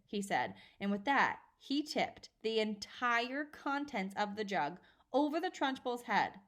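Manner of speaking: plain reading voice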